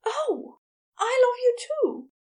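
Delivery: high, loud, emotional outburst; startled, high-pitched declaration of love; gasped realisation. A narrator's reading. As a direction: gasp it out as a sudden loving confession. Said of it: "and then gasped".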